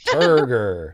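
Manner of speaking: "Turgor" loud with a strong er; stressed turgor. Shown as a demonstration. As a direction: punch the er in "Turgor". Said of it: "You gotta get the er".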